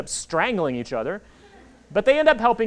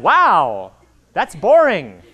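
louder, flat-out unimpressed verdict; deadpan put-down; 7 dB louder, much slower than usual. Retold or imitated. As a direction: knock the cover down bluntly. Author mocking the dull cover.

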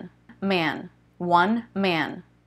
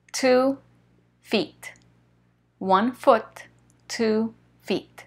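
ordinary delivery

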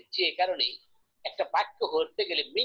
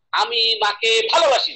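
loud demonstrated telling-off; mock scolding, punching "ভালোবাসি"; 3 semitones higher, 8 dB louder than usual. Shown as a demonstration. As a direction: fling it out as a rebuke, loud and raised. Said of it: "ধমক দিলাম".

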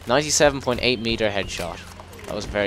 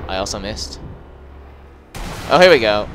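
ordinary talk, nothing marked

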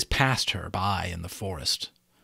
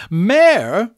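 loud, high, outraged question; indignant demand; 12 semitones higher, 10 dB louder than usual. A narrator's reading. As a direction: throw the word back, offended and piercing. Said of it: "she demanded".

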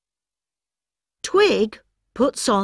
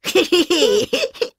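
giggle; 9 semitones higher than usual. Acted. Laugh after line.

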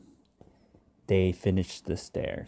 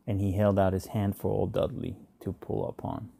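plain reading voice

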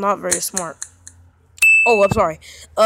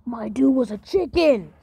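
ordinary delivery

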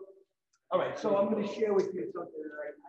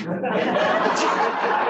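laugh; 7 semitones higher, 13 dB louder than usual. Real, not acted. Laughing at memory.

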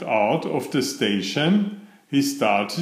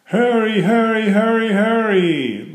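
high, singsong urging; teasing, high-pitched hurrying; gleeful taunt; 9 semitones higher, 4 dB louder than usual. A narrator's reading. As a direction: egg him on in a high, run-together call. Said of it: "laughed Gordon".

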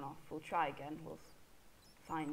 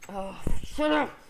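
weary snap; 4 semitones higher, 8 dB louder, slower than usual. Real, not acted. Scolding his hiccups again.

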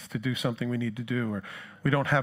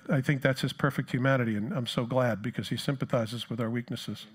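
normal talking voice

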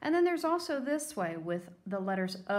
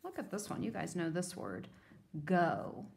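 ordinary talk

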